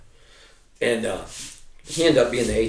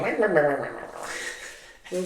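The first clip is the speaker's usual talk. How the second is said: mocking bleat; 6 semitones higher, 8 dB quieter, slower than usual. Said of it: "looking at your fucking belly buttons going".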